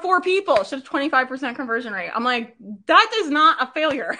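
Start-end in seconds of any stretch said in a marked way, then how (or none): 2.9-4.2 louder and higher, laughing through it; laughing disbelief. Real, not acted. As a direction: laugh while insisting on "That is not a failure.". Laughing at a client's success.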